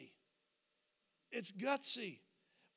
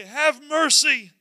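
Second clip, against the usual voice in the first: calling out; 4 semitones higher, 8 dB louder than usual. Acted. Blind men calling after Jesus for mercy, calling out. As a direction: cry out for mercy, raised and stretched. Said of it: "they're calling out, and they say".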